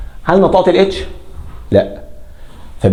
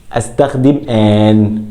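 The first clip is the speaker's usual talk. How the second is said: slow rule; 4 semitones lower, slower than usual. Shown as a demonstration. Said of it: "rule said slowly".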